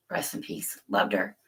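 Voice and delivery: very low, hushed, near tears. Real, not acted. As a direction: let the words sink away almost to a whisper, holding back crying. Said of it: "now I'm about to cry again".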